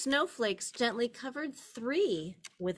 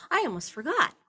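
higher, louder startled remembering; a farmer suddenly remembering; sudden realization; 6 semitones higher, 6 dB louder, much faster than usual. Acted. Farmer catching himself, startled and louder. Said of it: "said the farmer".

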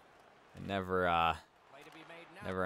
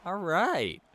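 excited; 12 semitones higher, 7 dB louder than usual. Real, not acted. Cheering after the goal.

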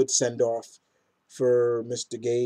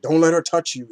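mock warning shout; 5 semitones higher, 7 dB louder than usual.

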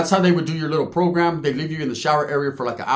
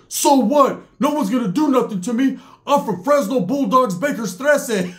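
high-pitched imitated bragging shout; defiant yelling; 8 semitones higher than usual. Fat Cat answers the accusing Bulldogs, defiant yelling. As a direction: proclaim it to the whole tier, undaunted. Accused man defies the whole tier.

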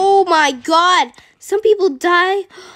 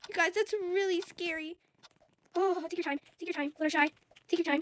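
hushed tension; 14 dB quieter, faster than usual. Real, not acted. Tense, watching friend cross.

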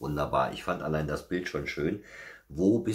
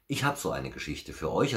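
ordinary delivery, nothing marked

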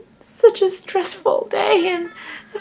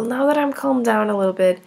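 normal talking voice

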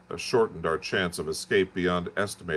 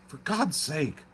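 frightened plea; 8 semitones higher, 4 dB quieter than usual. Acted. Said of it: "in a terror lest the Martians should hear us".

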